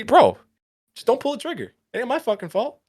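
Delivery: high-pitched, exasperated denial of blame; defensive protest. Acted. Protests blame in mock retort to companion.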